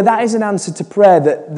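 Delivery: usual speaking voice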